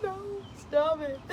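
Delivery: high, teary plea with a laugh; tearful protest. Retold or imitated. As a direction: whimper it, overwhelmed. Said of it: "I was like".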